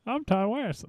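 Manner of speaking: high, thin mock old man voice; Ty West impression. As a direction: squeak it like a mocking caricature. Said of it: "his Ty West impersonation".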